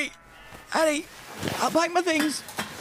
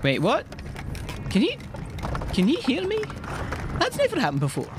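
startled; 7 semitones higher than usual. Acted. Hearing God's voice for first time.

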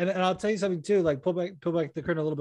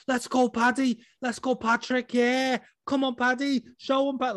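high Scouse-style cheering; imitated shouting out; 8 semitones higher than usual. Winner shouting out her friend Patty on the live, high cheering. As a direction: cheer them on in high, excited calls. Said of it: "she's shouting out Patty on the live".

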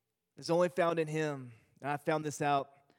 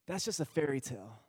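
lightly voiced sad daughter; 3 semitones lower, 4 dB quieter than usual. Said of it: "She says".

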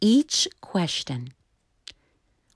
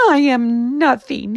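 high, louder despairing cry; 7 semitones higher, 6 dB louder than usual. Acted. Little tree Juniper crying out to the forest, despairing. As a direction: wail it like a lonely little tree. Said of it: "he cried".

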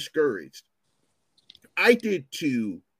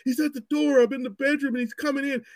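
high frantic mock scream; imitating a terrified caller, pitched high; retold panicked scream; 12 semitones higher than usual. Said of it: "she's screaming".

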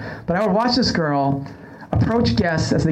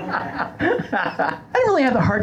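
laughing; 5 semitones higher, slower than usual. Real, not acted. Host laughing about the confused coworker. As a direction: still laughing, slowly.

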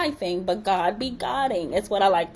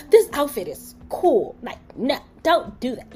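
mildly affronted, higher-pitched retort; offended; 4 semitones higher, 5 dB louder than usual. Retold or imitated. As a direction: push back in mock offence. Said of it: "really offended".

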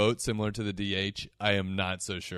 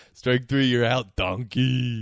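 loud, jeering strike call; umpire calling strikes; 3 semitones higher, 5 dB louder, slower than usual. Pretend.

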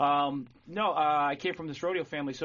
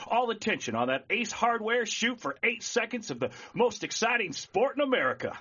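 booming, higher rodeo announcer call; 7 semitones higher, 3 dB louder than usual. Shown as a demonstration. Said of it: "a rodeo announcer would do this".

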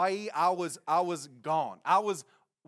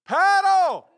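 very loud, high drawn-out shout; distant yell; 14 semitones higher, 14 dB louder than usual. Retold or imitated. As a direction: bellow it across the ocean. Friend yells back from afar.

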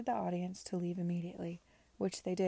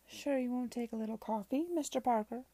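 higher-pitched, falsely hospitable villain; raised pitch, smooth offer; oily gambling-house owner; 5 semitones higher, 4 dB louder than usual. A narrator's reading. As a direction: play the insincere genial host. Voices Beecroft, the gambling-house owner.

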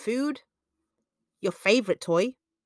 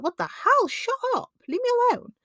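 high, exasperated cry to the voices; exasperated outburst. Retold or imitated. Speaker fed up with inner voices, exasperated high outcry. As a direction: shoo the nagging voices off, pitched way up. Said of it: "You're like".

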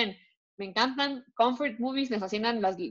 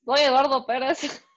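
mock vampire intro; 4 semitones higher, 4 dB louder than usual.

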